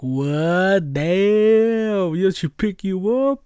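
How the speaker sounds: high, loud impressed gasp; impressed disbelief from the guys; amazed classmates. Retold or imitated. Classmates' amazed reaction quoted.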